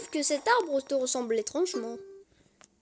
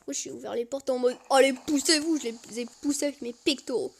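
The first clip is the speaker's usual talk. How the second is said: normal talking voice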